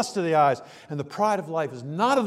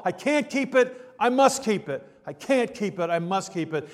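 alternating I can't and I must, trailing off; torn back and forth; 3 semitones higher than usual. Acted. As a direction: act out the inner tug-of-war, letting it die away. Acting out the law dilemma.

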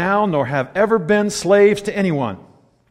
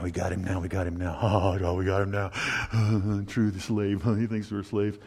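sneaky plotters; 8 semitones lower, 7 dB quieter than usual. Retold or imitated. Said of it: "they kinda go".

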